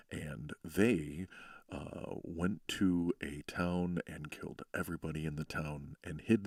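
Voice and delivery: low, resonant storybook read; reading a spooky bedtime story in a low voice. Shown as a demonstration. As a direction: rumble the tale low to lull a child. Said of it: "like a bedtime story".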